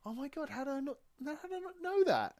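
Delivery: very high-pitched disbelief; astonished. Real, not acted. Fan learning who voiced the droid, astonished. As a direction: squeal it, amazed at yourself.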